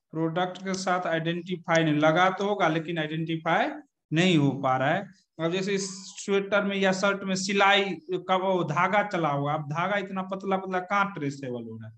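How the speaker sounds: usual speaking voice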